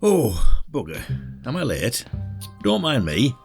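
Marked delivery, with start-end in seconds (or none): 0.0-1.0 loud, exasperated "oh"; flustered exclamation. Acted. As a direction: blurt it out loudly in dismay. Arriving late, flustered.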